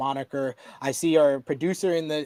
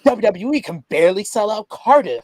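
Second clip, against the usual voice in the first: louder, higher retold scoff with laughter; 5 semitones higher, 5 dB louder than usual. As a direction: imitate a wife dismissing the whole idea. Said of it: "she kinda scoffed at it".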